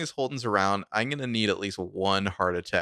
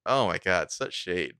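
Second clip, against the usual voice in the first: ordinary talk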